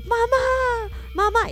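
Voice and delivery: high, drawn-out wail for mum; mock cry for help. Brother calling mum from the shower, high mock wail. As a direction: imitate a kid pleading to be rescued.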